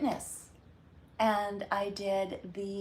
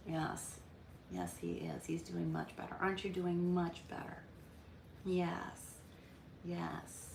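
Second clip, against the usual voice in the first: softer, lower praise to the dog; soft pet talk; 3 semitones lower, 11 dB quieter than usual. Real, not acted. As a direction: murmur gentle praise to your puppy.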